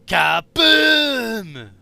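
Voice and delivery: loud, high Demoman battle cry; Demoman shout. Retold or imitated. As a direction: yell it like an explosive-happy Scotsman. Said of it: "started with the demo guy".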